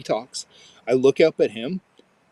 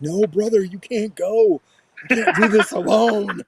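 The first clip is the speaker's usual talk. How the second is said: high, loud, dramatic pleading; kid brother pleading; 8 semitones higher, 5 dB louder than usual. Retold or imitated. Boy begging his dying brother not to leave, high and loud. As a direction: ham up the little brother's despair. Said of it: "He's like".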